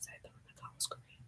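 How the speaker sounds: barely audible quick whisper; rushed confiding whisper. Real, not acted. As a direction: breathe it as a fast secret. Whispered aside.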